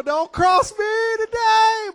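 high, loud, slow warning; sassy mock menace; hammed-up threat. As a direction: drag it out like a threat. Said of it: "You know".

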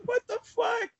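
high, laughing what the fuck; laughing disbelief. Real, not acted. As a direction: squeal it through laughter. Laughing at absurd scene.

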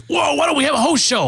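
high, hammed-up eureka voice; mock excitement. Hosts without a guest pitch their plan in mock excitement. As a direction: play it like a sudden bright idea.

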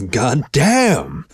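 "damn" drawn out high and loud; drawled, stress on last syllable. A narrator's reading. Dog finally reacts to her, drawling with a big high "damn". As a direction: drawl it, leaning hard on "damn". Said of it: "the dog finally drawled, emphasis on the last syllable".

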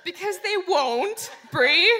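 loud call to a heckler. Real, not acted. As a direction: shout her name over the noise. Calls out the heckler.

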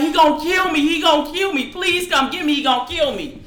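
high, pleading panic to dad; frightened plea. Retold or imitated. Begging father to come.

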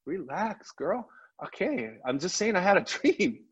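high-pitched mock protest to a wife; retold plea to calm down, pitched well up; defensive placating. Telling his wife it was only a dream, defensive and high.

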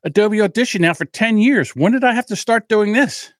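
mock outrage at the new rule; indignant. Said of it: "I said".

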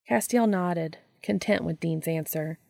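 ordinary delivery

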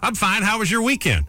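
high, chirpy store clerk voice; mimicking clerk. Host imitating the convenience store clerk, pitched high. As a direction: play the chatty counter lady. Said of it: "She says".

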